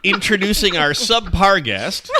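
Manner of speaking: laughing teasing shout. Real, not acted. Teasing the guest while laughing.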